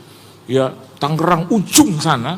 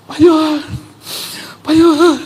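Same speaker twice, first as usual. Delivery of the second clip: high, loud, overwhelmed calling out; mock starstruck cry; 10 semitones higher, 3 dB louder than usual. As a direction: imitate an awestruck fan near tears. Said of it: "Dia gini". (meniru seruan haru penggemar, tinggi dan lantang)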